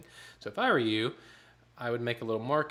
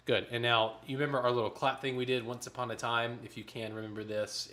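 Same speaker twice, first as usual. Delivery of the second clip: ordinary delivery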